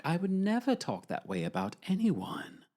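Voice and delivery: put-on demure protest; posh and demure. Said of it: "come off posh and demure".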